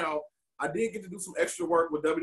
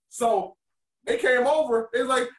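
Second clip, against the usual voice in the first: ordinary delivery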